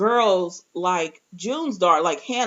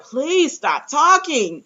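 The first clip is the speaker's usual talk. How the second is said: slow, drawn-out, higher-pitched begging; exasperated plea; 5 semitones higher, 4 dB louder, much slower than usual. Retold or imitated. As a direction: stretch each word in mock exasperation. Acted plea to stop talking.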